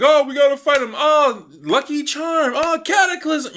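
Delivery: high, loud squeaky hero voices; mock hero banter. Reviewer mimicking Ladybug and Cat Noir fighting, squeaky and high. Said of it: "saying things like".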